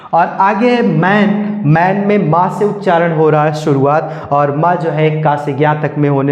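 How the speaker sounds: ordinary delivery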